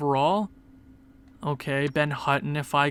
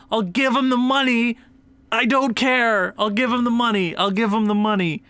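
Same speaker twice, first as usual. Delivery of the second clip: excited rant; 5 semitones higher than usual. Real, not acted. Superfan demanding his favourite player, excited rant. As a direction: hype it up like a superfan begging. Hyped rant about wanting Phil.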